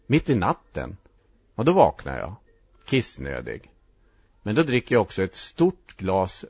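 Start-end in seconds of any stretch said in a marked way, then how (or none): none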